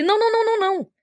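high, rapid string of "não"; emphatic denial. Retold or imitated. Reassuring her boss it was not his fault, high emphatic no. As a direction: brush off his worry with a flurry of no.

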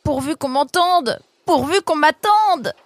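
high, loud breathless calling out while running; running shout. Acted. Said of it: "Il hurlait".

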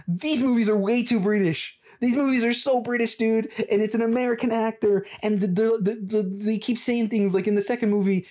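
high-pitched, exaggerated complaining; hammed-up indignation; mock-outraged rant. Mock-outraged rant listing his rips.

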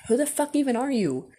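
high-pitched mock indignation; indignant disbelief. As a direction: replay your baffled annoyance, pitched high. Acting out indignation at strangers staring.

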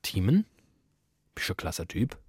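hint of a mother telling her son she's proud; mother's praise. Retelling his mum praising him, in a soft lightly voiced mother tone. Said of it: "Außer wenn deine Mama sagt".

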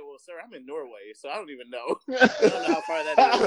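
high, laughing agent voice, unsure of the distance; hammed-up Norway agent laughing at the distance; mock agent. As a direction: play the clueless agent, cracking up. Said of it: "He was like".

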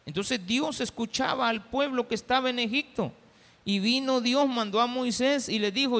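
normal talking voice

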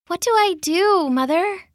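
high, small, lost voice; tearful pleading. A narrator's reading. Daughter asks her enchanted mother for help, tearful pleading. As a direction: plead helplessly, pitched well up. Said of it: "she whispered".

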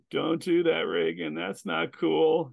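high, drawn-out imitation of a high viewer; slow stoned heckler. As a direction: talk slowly back at the screen.

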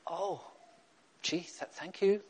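imitated English mother, soft and hesitant; halting English-accented thanks, softly. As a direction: mimic a reserved English mother stammering thanks. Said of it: "My mother very English".

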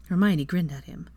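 usual speaking voice

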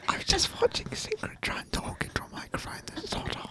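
hushed whispered test; ASMR whisper. Pretend. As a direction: whisper close to the mic. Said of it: "let's do some A S M R".

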